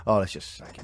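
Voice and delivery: a low muttered jeer from the stands; mock fan voice. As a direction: sneer it like a fed-up fan. Mocking the crowd's reaction.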